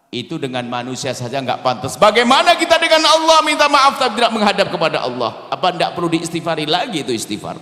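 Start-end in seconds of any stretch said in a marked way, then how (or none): 2.0-5.5 loud, high rebuke to the congregation; loud rebuking sermon line; fiery preaching. Real, not acted. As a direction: preach it out with full force. Preaching at full force.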